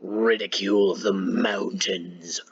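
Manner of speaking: slow breathy whisper. Acted. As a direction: whisper it slowly, mysterious. Reads quote in whisper.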